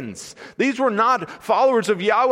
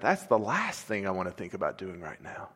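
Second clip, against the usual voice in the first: low, much softer grudging mumble; reluctant grumble; 12 semitones lower, 11 dB quieter than usual. Retold or imitated. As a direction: mumble it like he wants none of it. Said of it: "he went".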